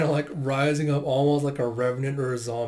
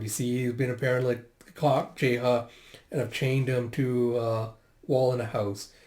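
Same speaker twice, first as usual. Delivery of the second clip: usual speaking voice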